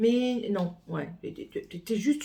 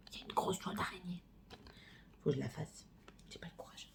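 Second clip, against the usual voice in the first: whisper; 2 semitones lower, 14 dB quieter, faster than usual. Real, not acted. Confiding aside to viewers.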